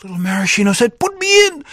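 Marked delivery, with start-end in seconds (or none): 1.0-1.6 very high squeaky plea; tiny pleading voice. Acted. Little cherry begging the girl to play, tiny squeak. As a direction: beg like a tiny hero.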